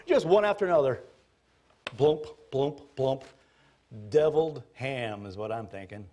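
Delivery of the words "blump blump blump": splash noises. Pretend. Pigs falling into sea.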